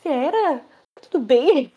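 imitating the shop owner knocking on the bathroom door; worried knock call. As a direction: call out politely, a bit anxious. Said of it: "a mulher batendo na porta".

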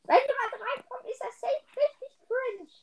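high put-on baby voice. Player worrying someone might join, baby voice. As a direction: speak right up high like a baby. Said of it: "Lass mit Babystimme reden".

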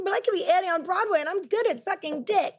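hammed-up little-kid protest; mock stage kid. Mock child pleading to perform.

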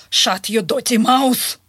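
slow, deliberate mock telling-off; angry put-down. Russian lady to the driver before leaving, slow angry put-down. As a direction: imitate an offended lady's parting insult, each word drawn out. Lady's angry parting insult, retold.

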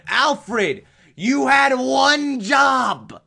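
you had one job, bellowed; mock furious yell. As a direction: shout it high and loud, drawn out slow. Yelling at Alfred over failure.

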